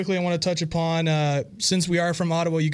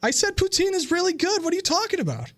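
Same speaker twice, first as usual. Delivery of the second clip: high-pitched, incredulous comeback; affronted, much higher in pitch; indignant protest; 11 semitones higher than usual. Real, not acted. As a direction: defend it in disbelief, voice jumping up. Host defends poutine against guest's insult.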